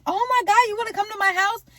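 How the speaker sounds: high-pitched mock excited kid; excited little girl. Little girl to celebrity about visiting her house, excited. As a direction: squeal it like a thrilled little girl.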